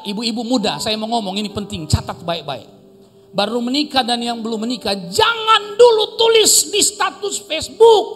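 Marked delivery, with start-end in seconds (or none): 5.0-8.1 high, loud and slowed on the warning; emphatic warning. Real, not acted. Pastor warns newlyweds, high and loud.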